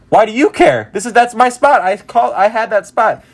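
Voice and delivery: high, loud, heated comeback; indignant replay of a quarrel; indignant retort. Retold or imitated. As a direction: throw the challenge back, pitch way up. Acts out quarrel over his seat.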